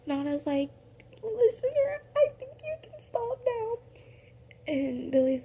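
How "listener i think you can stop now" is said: very high piping voice; deadpan plea in a high squeak; very high, dry plea. Retold or imitated. Nana telling the listener to stop, in a very high voice.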